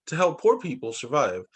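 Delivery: ordinary delivery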